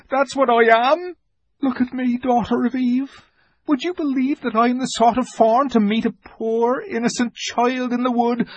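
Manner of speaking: high, pleading guilty fawn; a creature begging not to be judged. Acted. The fawn confesses his plan to Lucy, guilty.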